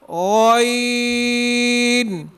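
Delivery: غین held very long from the throat; letter demo. Shown as a demonstration. Teacher introducing the next letter, a long غین. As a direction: stretch out غین slowly. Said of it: "معمولی سا کھینچنا چاہیے".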